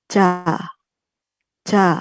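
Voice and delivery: easy loose "ja"; plain ja. Shown as a demonstration. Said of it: "plain kita baca". ("ja" santai)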